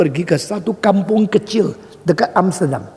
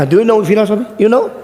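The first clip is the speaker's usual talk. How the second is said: higher, louder taunting question; challenging; 6 semitones higher, 4 dB louder, faster than usual. Retold or imitated. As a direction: imitate a cocky student daring the stranger.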